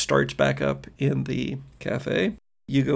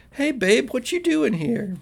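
put-on greeting girlfriend; 10 semitones higher than usual. Youth greets his girlfriend at the door, delighted. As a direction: call out playfully, pitched way up. Game master plays boyfriend greeting Cassie.

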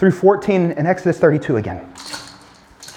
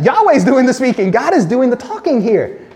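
emphatic answer; 6 semitones higher than usual. Real, not acted. Preacher answers his own question, raised and emphatic. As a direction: proclaim the answer to the room. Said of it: "You can say it out loud".

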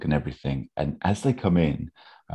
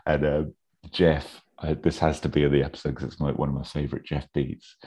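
ordinary talk, nothing marked

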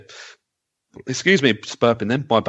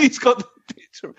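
cracking up; 10 semitones higher than usual. Real, not acted. Still cracking up over the comment.

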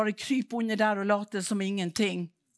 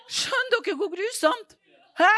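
loud, higher, laughing cry; laughing in disbelief; 12 semitones higher, 8 dB louder than usual. Real, not acted. Preacher to congregation, laughing loud and high. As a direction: laugh it out in shock.

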